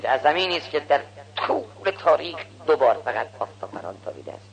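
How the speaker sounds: ordinary talk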